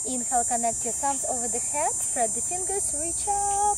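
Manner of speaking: calm, steady yoga teacher voice; guided yoga instruction. Acted. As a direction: lead the class through the pose. Leads a yoga practice.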